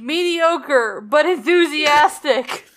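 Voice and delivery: high, slow mock judgment; playful verdict. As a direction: deliver it like a teasing judge. Joking verdict on ring.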